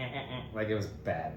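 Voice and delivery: ordinary talk, nothing marked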